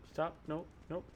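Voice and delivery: ordinary talk, nothing marked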